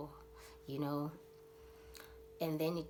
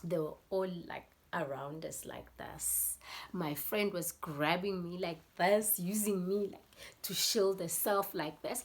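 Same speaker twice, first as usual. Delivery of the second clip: ordinary delivery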